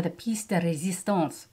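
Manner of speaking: hammy French accent, joking at herself; bad French accent. Shown as a demonstration. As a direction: piece de resistance in a self-mocking French accent. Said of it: "my French accent is horrible".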